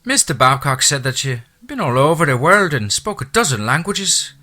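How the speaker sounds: a chatty Irish housekeeper's brogue; thick Irish brogue. A narrator's reading. Irish housekeeper welcoming the new owner, thick Irish brogue. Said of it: "her lyrical accent".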